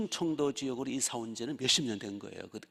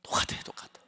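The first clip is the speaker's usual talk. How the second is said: whisper. Real, not acted. Marvelling aside.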